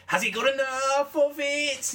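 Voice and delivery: high, drawn-out excited commentator impression; over-the-top commentator's cry, slow and high; Nick Knight. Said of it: "it's just his style is so ridiculous".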